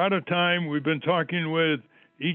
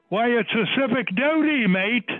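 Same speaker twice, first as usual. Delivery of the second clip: Aussie cab driver, pitched up; Australian accent; 8 semitones higher than usual. Retold or imitated. Guest coached on imitating a Sydney cab driver, Australian accent. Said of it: "make sure you do the right accent".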